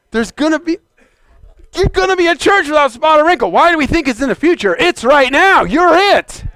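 high-pitched, pressing proclamation; urgent preaching. Real, not acted. Preacher insisting the church is spotless now, urgent preaching.

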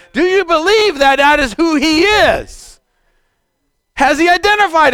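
high, loud, ringing altar call; fervent preacher's plea; preaching at full force. Real, not acted. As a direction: build the appeal, soaring. Preaching climax, rising appeal.

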